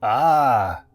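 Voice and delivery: loud Eastern European Ah; accented exclamation. A narrator's reading. Hypnotist realising Benson's request, accented exclamation. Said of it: "he exclaimed".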